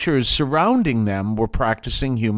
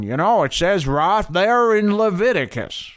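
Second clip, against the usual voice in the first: put-on higher-pitched Southern accent; 6 semitones higher than usual. Quoting those who cite Leviticus, in a Southern accent. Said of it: "I'm doing a Southern accent".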